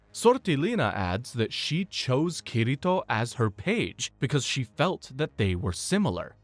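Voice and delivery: ordinary delivery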